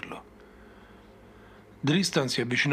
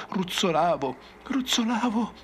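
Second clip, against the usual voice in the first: climbing higher with each repeat, frantic; rising panic; 7 semitones higher than usual. Acted. Survivor relives his tumble to the ranger, pitch climbing in panic. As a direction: spiral up into terror. Crazed survivor recounts falling.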